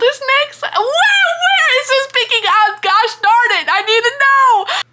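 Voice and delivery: high, loud, near-crying plea; can't stand not knowing; desperate excitement. Real, not acted. Desperate for next book.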